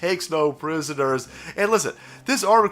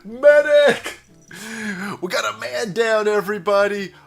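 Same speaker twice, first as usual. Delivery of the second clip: man down shout; 2 semitones higher than usual. Pretend. Mock alarm at political clip.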